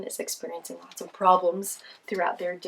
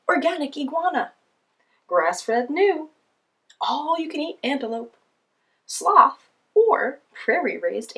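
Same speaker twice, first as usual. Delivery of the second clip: lion voice; 6 semitones higher, 6 dB louder than usual. Acted. Lion reads the menu.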